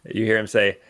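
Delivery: usual speaking voice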